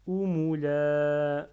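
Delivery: "Oumoula" with the final "la" drawn out long and loud; prolonged ending. Shown as a demonstration. Teacher showing a prolongation at the end, long final vowel. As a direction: stretch the last vowel of Oumoula. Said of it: "il y a un prolongement à la fin".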